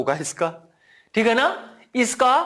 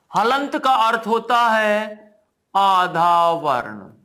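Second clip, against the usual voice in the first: slow dictation; slower than usual. Shown as a demonstration. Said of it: "teacher repeats definition slowly".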